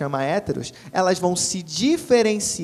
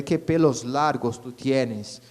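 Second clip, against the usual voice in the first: slow Spanish example; 3 semitones lower, much slower than usual. Shown as a demonstration. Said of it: "em espanhol, a gente vai dizer".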